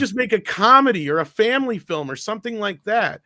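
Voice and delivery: loud, high-pitched mock exasperation; retold rage. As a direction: imitate an exasperated, raging demand. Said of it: "in a fit of rage".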